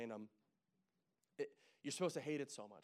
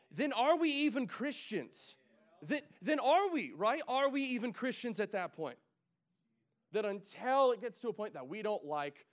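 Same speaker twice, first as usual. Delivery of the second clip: high, loud, slow pulpit exhortation; fired-up preacher pushing the church to act; preaching at full force; 9 semitones higher, 14 dB louder than usual. Acted. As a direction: drive it home to the congregation, slow and loud.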